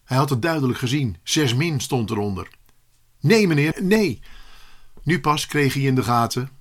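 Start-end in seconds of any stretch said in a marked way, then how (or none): 3.2-4.2 higher, louder pleading no; urgent denial. Acted. Pupil hurriedly stopping his teacher, urgent denial. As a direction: protest it urgently.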